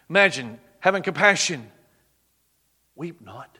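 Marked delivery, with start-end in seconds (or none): 3.0-3.6 soft, hushed and tender; tender comfort. Acted. Jesus softly tells the weeping mother, hushed tenderness. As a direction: gently soothe a grieving mother.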